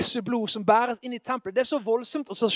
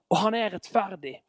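ordinary talk, nothing marked

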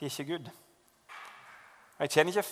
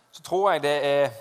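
ordinary talk

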